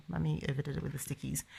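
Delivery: very soft quick aside; muttered aside. Real, not acted. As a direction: mumble it to yourself.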